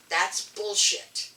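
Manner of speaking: slow, loud blurt of disgust; rather loud retort. Retold or imitated. Blurting out at the conference microphone line, loud and slow. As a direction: spit it out slowly and loud, fed up. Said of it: "rather loudly".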